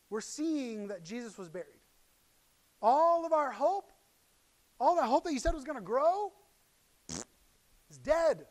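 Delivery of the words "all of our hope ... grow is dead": preaching at full force. Acted. Preacher voicing the disciples' despair to the congregation, loud. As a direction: cry out the loss of hope.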